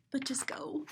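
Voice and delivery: very soft whispered plea. Acted. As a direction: breathe the order.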